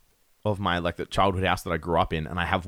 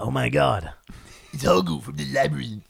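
higher, excited cartoon stoner fan; almost Otto; 5 semitones higher than usual. Guest imitating an online commenter recognising a film character, almost Otto voice. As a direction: put on a goofy, thrilled fan voice. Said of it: "that was almost Otto from The Simpsons".

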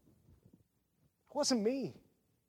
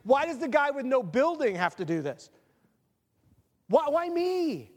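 whining complaint; 7 semitones higher, 3 dB louder than usual. Retold or imitated. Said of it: "and I'm like".

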